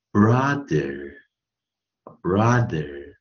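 BROTHER said slowly with an "uh" vowel; word demo. Shown as a demonstration. Teacher showing how the O is read, word demo. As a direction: model "brother" twice, syllables drawn out. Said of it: "这边念".

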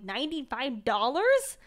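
loud, rising disbelief; incredulous, voice climbing; shocked question. Retold or imitated. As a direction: balk at the price, loud at the end. Shocked haggling over the price.

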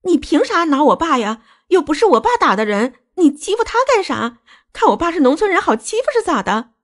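very high-pitched angry protest; indignant yelling. Acted. Daughter defending her clawed father from mother-in-law, yelling. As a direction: cry out in outrage, pitch way up. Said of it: "大喊道".

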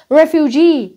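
Refugee stressed on the final GEE; third-syllable stress. Shown as a demonstration. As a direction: lean on the last syllable of "Refugee". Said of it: "nó là âm tiết ba".

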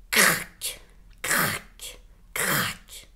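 crac whispered low and breathy, three times; whispered crack sounds. Acted. Narrator mimics twigs snapping in the woods, whispered cracks. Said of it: "de drôles de craquements".

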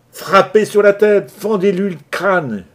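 high, loud savage yell. Acted. Johnson urging the attackers on, howling. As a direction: scream the order to crack his skull. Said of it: "hurlait Johnson".

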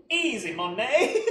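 loud, slow catchphrase call, laughing through it; quoting Hunter's catchphrase. Retold or imitated. Vlogger quoting Hunter's catchphrase, slow loud laughing call. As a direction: belt it out like a catchphrase joke. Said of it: "that shitty thing Hunter says".

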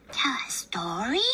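hushed unvoiced surprise; surprised whisper. Chicken amazed Papa asks her for a story, whispered. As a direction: breathe the question in wonder.

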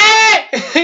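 high-pitched burst of laughter. Real, not acted. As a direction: laugh out in disbelief. Risa al final del capítulo. (risa aguda de incredulidad)

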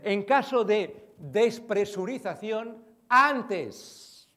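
slow, loud, deliberate safety announcement; flight attendant announcement. Retold or imitated. Speaker imitating the in-flight safety message to the audience, slow and loud. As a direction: spell it out like cabin crew over the speaker.

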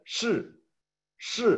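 "shi" said high, falling; Mandarin shì. Shown as a demonstration. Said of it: "el verbo ser".

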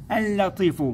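اللطيف pitched high with a long doubled L; sun lam. Shown as a demonstration. Teacher confirming the answer for pupils, sun lam. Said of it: "لام شمسية".

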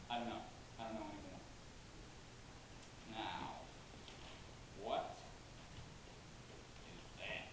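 low and soft, with hesitant pauses; hushed, tentative aside; quiet, doubtful musing. Acted. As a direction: drop to a musing undertone. Hesitant, offhand lead-in to pitch.

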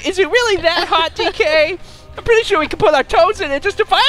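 high, playful little monkey voice; Diddy Kong voice. Retold or imitated. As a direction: pipe it up high like a cheeky sidekick. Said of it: "Diddy Kong was like".

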